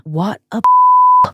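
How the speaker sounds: hammed-up Brooklyn shock at the rude date; a squealed Brooklyn accent, cut off; Brooklyn outrage. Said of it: "Sorry for that accent there".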